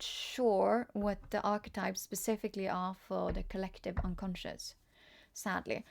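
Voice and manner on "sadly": ordinary talk, nothing marked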